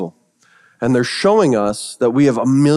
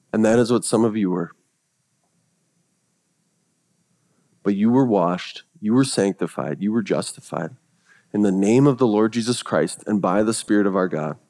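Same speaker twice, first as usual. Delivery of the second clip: low, quiet and tender reading; soft scripture reading; 5 semitones lower, 5 dB quieter than usual. Acted. Preacher reads Paul reminding them they were washed, softly. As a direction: let the words settle gently.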